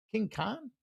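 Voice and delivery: King Kong? asked back with a rising, doubtful lift; puzzled echo. Real, not acted. Echoing a viewer's odd pick, puzzled and rising. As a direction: repeat the pick like you can't believe it.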